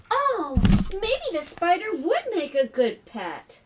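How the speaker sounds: high, loud storybook wonder; delighted realization. Acted. Family reconsidering the spider as a pet, delighted realization. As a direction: warm to the idea with surprise.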